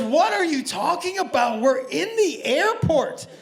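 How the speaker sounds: mock an incredulous man arguing back; baffled protest. Man arguing with a woman at the airport, exasperated. Man answering in retold quarrel.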